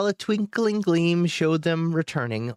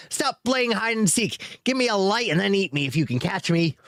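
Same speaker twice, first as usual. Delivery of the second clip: squeaky taunt; 7 semitones higher than usual. A narrator's reading. Hobbit dares the dragon to eat him, squeaky. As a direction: dare the dragon, squeaking high. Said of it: "he squeaked aloud".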